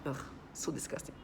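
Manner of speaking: a whispered, sickened ugh; whispered real disgust. Real, not acted. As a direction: breathe it out in revulsion, voiceless. Real revulsion recalling the kid.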